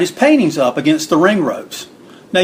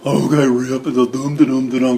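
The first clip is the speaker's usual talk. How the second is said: dopey big-lug voice; Swede Hansen imitation; 3 semitones lower than usual. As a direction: play the dim sidekick plodding off. Imitating slow-witted Swede trudging off.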